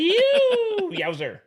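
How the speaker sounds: high squeal of laughter breaking into yowzer; laughing fit. Real, not acted. Co-host cracks up at the ball golf joke, squealing laugh.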